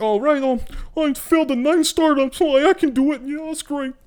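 mock influencer. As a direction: gush like a fake-positive influencer. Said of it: "Just being like".

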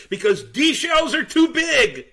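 put-on high, loud, drawn-out kid voice; imitating excited boy. Tour guide voicing the boy's punchline, excited. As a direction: shout it high like a kid with the answer. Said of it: "he jumps up and down".

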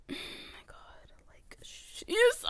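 exasperated whisper; breathy annoyed whisper. Real, not acted. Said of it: "sighs whisper".

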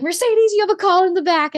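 high, loud call across the store; retold scream. Manager calling her away from the patient, loud high yell. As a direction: yell it out as a cover signal. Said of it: "And Linda just screamed".

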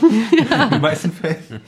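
laughing. Real, not acted. Laughing while speaking.

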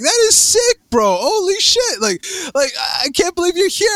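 high, excited gushing; thrilled, high-pitched disbelief; retold amazement. Retold amazement at Freddie's new job.

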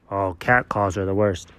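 usual speaking voice

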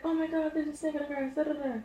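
hammed-up fright at the snake; mock panic. Friend finds the snake outside, mock panic.

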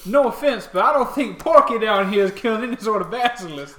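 high, loud skeptical shopkeeper; mock the claim loudly; scoffing.

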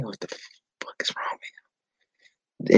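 a hushed, appalled aside; disgusted whisper. Real, not acted. Disgusted aside about dancing Peter.

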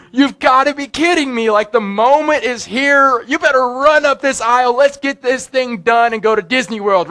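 eager groom. Retold or imitated. Groom's excited thoughts at altar.

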